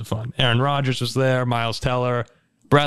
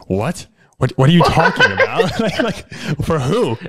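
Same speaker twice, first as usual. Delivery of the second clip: retold surprise; 4 semitones higher, 4 dB louder than usual. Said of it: "I said".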